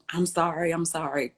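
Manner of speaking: usual speaking voice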